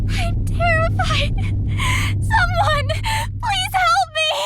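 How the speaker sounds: loud, high tearful plea; terrified crying. Acted. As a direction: sob out a desperate cry for help. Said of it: "are you crying".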